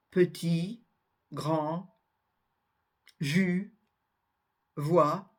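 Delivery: petit, grand, jus, voix, each ending on the vowel; word by word: petit, grand, jus, voix, last letters silent; petit, grand, jus, voix as isolated teaching examples without final consonants. Shown as a demonstration. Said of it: "ne sont pas prononcées".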